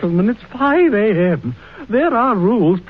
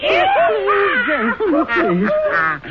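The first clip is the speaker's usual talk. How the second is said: high, raised pleading, slower on gentlemen; pleading clerk; 14 semitones higher, much slower than usual. Acted. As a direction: beg the rowdy guests to stop, voice pitched up. Clerk begs rowdy drunks to stop.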